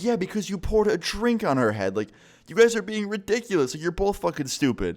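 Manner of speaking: high-pitched mock telling-off; exasperated scolding. Friend scolding the boyfriend mid-fight, exasperated. Narrator's retort in acted argument.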